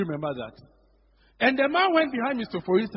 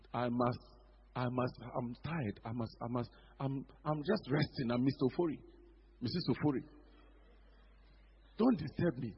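lazy excuse; 8 semitones lower, 8 dB quieter than usual. Retold or imitated. Preacher mimicking members who claim they are too tired. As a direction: sound too tired to bother.

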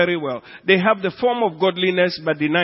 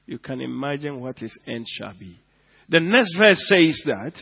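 ordinary talk

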